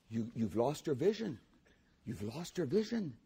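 ordinary talk, nothing marked

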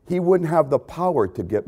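ordinary talk, nothing marked

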